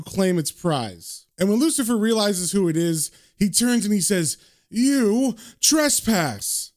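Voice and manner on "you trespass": high, drawn-out devil's accusation; raised pitch, very slow and affronted; indignant accusation. A narrator's reading. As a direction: stretch both words in outrage. Lucifer confronts the intruder.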